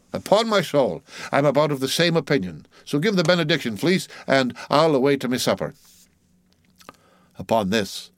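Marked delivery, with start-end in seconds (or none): 0.1-5.7 lightly voiced mate, pitched up; breezy ship's mate. Mate to old cook about the benediction, breezy. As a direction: agree heartily, then give the order.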